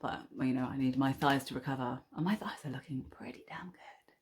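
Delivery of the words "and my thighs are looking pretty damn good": cheeky whisper; whispered aside. Real, not acted. Vlogger confiding about her thighs, whispering. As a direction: whisper it as a proud secret.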